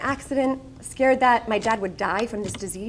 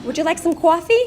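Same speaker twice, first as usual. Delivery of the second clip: broad Long Island waitress asking would you like some coffee; heavy Long Island diner accent, louder and higher; 6 semitones higher, 7 dB louder than usual. Retold or imitated. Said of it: "aware of my Long Island accent".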